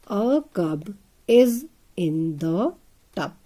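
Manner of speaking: very slow, every word drawn apart; slow dictation. Shown as a demonstration. Teacher dictating the sentence to kids, very slow. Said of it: "with a full stop".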